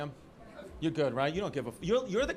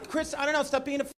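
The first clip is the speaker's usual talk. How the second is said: mock careless woman; 8 semitones higher, faster than usual. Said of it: "She's the type".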